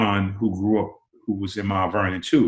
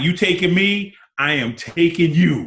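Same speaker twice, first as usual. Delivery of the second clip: raised, pushy challenge; a playful dare; cocky challenge; 8 semitones higher, 4 dB louder than usual. Retold or imitated. Retold challenge to Mike.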